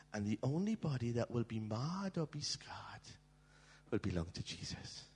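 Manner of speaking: hushed, reverent whisper. Real, not acted. Preacher describing Jesus' scars, whispered. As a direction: breathe it softly to the crowd.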